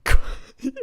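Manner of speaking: burst of laughter. Real, not acted.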